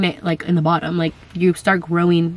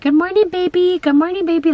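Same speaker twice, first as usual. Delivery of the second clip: put-on much higher, sing-song child voice; imitating her kids; 10 semitones higher than usual. Kids greeting the unborn baby each morning, cooing. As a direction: coo to the baby in the belly like a small child. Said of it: "And they're like".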